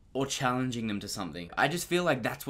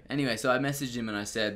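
ordinary talk

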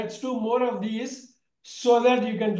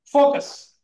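a louder, higher, firm call to focus; firm command; 5 semitones higher, 7 dB louder than usual. Real, not acted. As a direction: snap the class to attention with Focus. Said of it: "don't waste your time".

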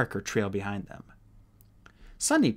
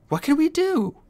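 high, louder frantic cry; panicked shout; 6 dB louder than usual. A narrator's reading. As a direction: panic boils over.

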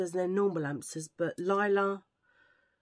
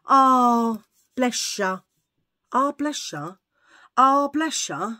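loud, drawn-out pitying coo; patronising vet; 5 semitones higher, 9 dB louder, much slower than usual. Retold or imitated. Vet patronising a crying owner with a drawn-out coo. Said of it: "she was really patronising".

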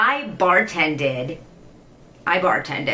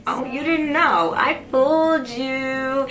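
teasing; 7 semitones higher, slower than usual. Real, not acted. Teasing reveal after speaking Greek.

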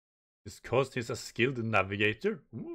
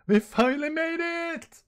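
much higher, joyful cry; excited cheer; 6 dB louder than usual. Acted. Crew arriving at Elbaf, high excited cheer. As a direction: shout it out like finally arriving. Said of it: "They said".

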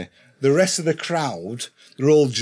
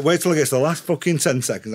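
normal talking voice

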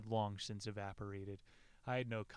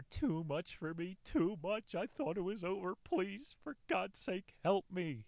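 pleading; 8 semitones higher than usual. Retold or imitated. Reading Joe's desperate plea.

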